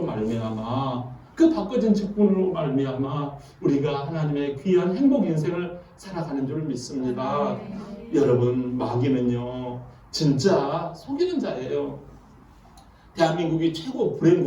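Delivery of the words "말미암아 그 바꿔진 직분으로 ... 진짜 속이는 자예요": low intimate preaching. Acted.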